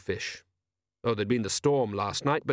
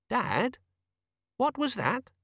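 high, puzzled son's voice; bewildered son; 12 semitones higher, slower than usual. A narrator's reading. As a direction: ask Dad in high disbelief. Son puzzled after strange encounter.

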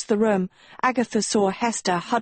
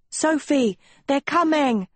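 high, contained narrator's scream; urgent warning pitched well up without full volume; 7 semitones higher than usual. A narrator's reading. Dot warning Sophie of the attackers, a contained scream. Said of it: "Dot screamed".

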